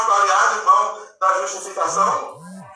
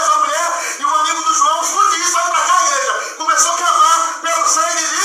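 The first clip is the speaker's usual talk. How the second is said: fervent preaching; 7 semitones higher than usual. Acted. Preaching at full force.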